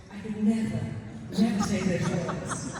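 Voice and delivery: loud, slowing protest, acted out; shocked refusal. Client refuses the therapist's swearing request, shocked protest. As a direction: protest loudly, dragging out the second never.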